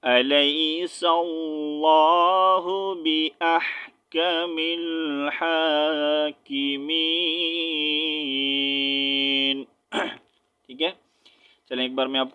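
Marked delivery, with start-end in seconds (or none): 0.0-9.6 slow, melodic tajweed chant; Quran recitation. Shown as a demonstration. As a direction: sing out the verse slowly and melodiously. Recites the finished verse.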